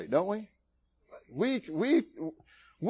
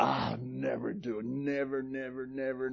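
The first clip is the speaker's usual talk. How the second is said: muttered vow; 2 semitones lower, 11 dB quieter than usual. Acted. Acts Peter's puffed-up denial.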